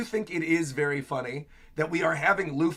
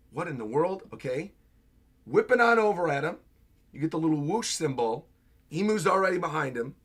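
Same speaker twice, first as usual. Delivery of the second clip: ordinary talk, nothing marked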